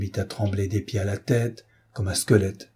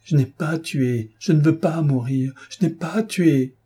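crying out; 6 semitones higher than usual. A narrator's reading. Condemned boy protesting innocence, high pleading outcry. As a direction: cry out in revolt against death. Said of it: "il criait".

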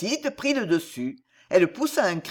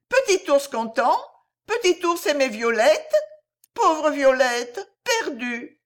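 put-on a small child remembering she is lost; little girl voice; 8 semitones higher than usual. Lost princess Violette tells Ourson she is lost, high tearful child voice.

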